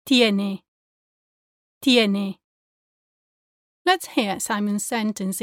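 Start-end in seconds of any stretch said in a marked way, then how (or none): 0.1-0.6 "tiene" with tongue touching the back of top teeth; soft dental T in "tiene", no puff of air; soft Spanish T. Shown as a demonstration. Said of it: "does not have that puff of air". 1.8-2.4 "tiene" with tongue touching the back of top teeth; soft dental T in "tiene", no puff of air; soft Spanish T. Shown as a demonstration. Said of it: "does not have that puff of air".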